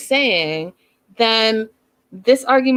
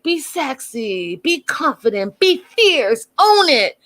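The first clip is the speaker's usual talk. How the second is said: higher-pitched, drawn-out stage-mom coaching; 9 semitones higher, slower than usual. Retold or imitated. Pushy stage mom coaching him through a photo shoot, high-pitched mock commands. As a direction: fire off posing orders like a pushy stage mom. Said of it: "like, a stage mom".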